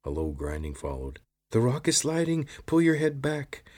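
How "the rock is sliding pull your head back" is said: loud, much higher alarm; urgent warning shout. A narrator's reading. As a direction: yell it as the rock slips. Rock slides, warning shout.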